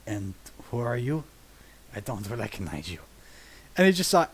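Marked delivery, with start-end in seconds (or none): 0.1-3.0 low, hushed ghostly voice; Sevatar. Retold or imitated. Game master turns on a player as Sevatar, low ghost voice. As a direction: speak low and soft, coolly challenging a stranger. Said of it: "just in Sevatar's ghost voice go".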